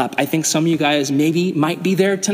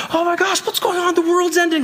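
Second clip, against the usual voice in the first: much higher, hammed-up alarm; mock freaking out; 13 semitones higher than usual. Pastor retelling his blackout panic, hammed-up high alarm. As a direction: play yourself panicking, voice way up. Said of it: "And I'm like".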